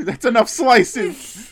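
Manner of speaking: laughing. Real, not acted. Mock complaint while laughing.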